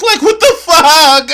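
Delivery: loud, high, laughing disbelief; cracking up in loud disbelief; incredulous laughing shout. Real, not acted. Mocking clip, laughing incredulously.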